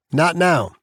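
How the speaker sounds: irritated warden. A narrator's reading. Warden rebuffing inmate before guests, irritated brush-off. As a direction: shut down an interruption. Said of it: "they can't see how pissed".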